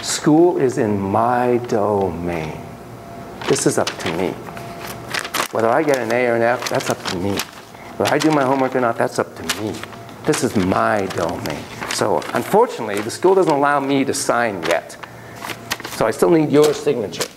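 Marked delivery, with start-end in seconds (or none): 0.0-11.6 put-on low, measured, self-possessed kid; child claiming his domain. Eight-year-old telling mom school is his business, calmly firm. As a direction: state it firmly in a lower, quieter register. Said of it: "he's saying".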